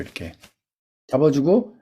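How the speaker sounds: usual speaking voice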